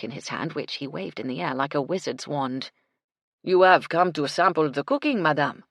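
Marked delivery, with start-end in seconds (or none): 3.4-5.6 put-on loud French-accented chef's voice. French chef asks madame about sampling his cooking, French-accented. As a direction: ask grandly in a French accent.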